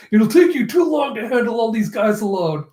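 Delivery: high-pitched playful jab; amused teasing. Acted. Luffy teasing, teller laughing.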